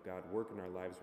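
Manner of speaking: normal talking voice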